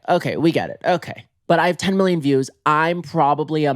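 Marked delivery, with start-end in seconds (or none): none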